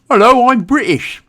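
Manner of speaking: high, loud mock posh British voice; American take on British. Mocking how Americans hear the British, in a mock British accent. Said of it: "every British person talks like this".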